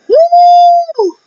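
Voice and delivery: high, loud excited woo; ric flair woo. Acted. Hyped fan whooping about the main event, loud woo. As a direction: let out a wrestling woo.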